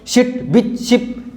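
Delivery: "शिट, बिच, शिप" quick short i; short clipped vowels. Shown as a demonstration. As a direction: clip each short vowel sharp. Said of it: "ये थोड़े short". ("शिट, बिच, शिप" छोटी आवाज़ में)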